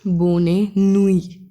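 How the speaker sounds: बोने नुई said slowly as French bonne nuit. Shown as a demonstration. Teacher slowly models बोने नुई for students, in French. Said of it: "मैं दोबारा से लिख देता हूँ".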